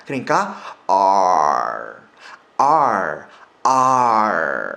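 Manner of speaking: R drawn out slowly, tongue curling back; slow curled American R; slow rolled R. Shown as a demonstration. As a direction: stretch each R, slow and curled. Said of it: "하고 굴리셔야 됩니다".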